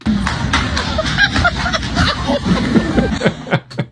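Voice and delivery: real laughter, high and breathless; cracking up at the clip; high helpless laughter. Real, not acted. Reacting to a woman tripping in the clip, real high laughter.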